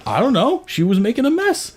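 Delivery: put-on high-pitched child's excuse; retold whiny protest of innocence; defensive kid. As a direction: plead ignorance like a kid caught out. Voices son's defensive answer.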